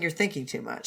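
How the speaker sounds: low, soft, dismissive non-answer; low curt mutter. Acted. Reader voices Lan Wangji dodging the question, low and soft. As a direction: brush him off quietly.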